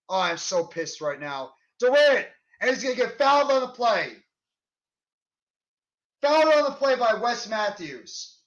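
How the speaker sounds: ordinary delivery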